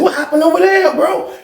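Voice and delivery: giggly nosy friend digging for gossip. Retold or imitated. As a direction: lean in and pry, half laughing. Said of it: "I'm getting super nosy".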